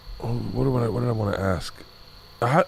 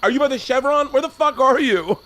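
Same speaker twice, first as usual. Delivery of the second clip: high-pitched exasperated yelling; mock meltdown; 13 semitones higher than usual. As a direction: re-enact a frantic Uber meltdown, much higher. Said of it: "I melted down trying to get an Uber".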